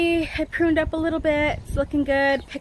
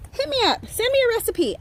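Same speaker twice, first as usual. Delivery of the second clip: playful plea; 10 semitones higher than usual. Real, not acted. Asking viewers for eggplant recipes.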